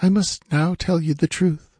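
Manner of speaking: high, measured female character voice; regal woman. A narrator's reading. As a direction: reveal the truth with new regality. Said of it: "drawing herself up with a new regality".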